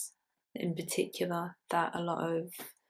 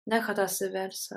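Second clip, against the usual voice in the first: measured poetry recital, firmer than chat; poem reading; 5 dB louder than usual. Acted. Poet reading a pickling poem to an audience, measured recital. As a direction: read it out in deliberate phrases with pauses.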